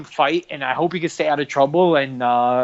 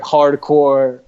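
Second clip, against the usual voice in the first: ordinary talk, nothing marked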